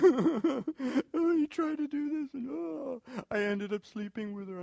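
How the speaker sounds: high-pitched hammed-up lament; mock wailing confession.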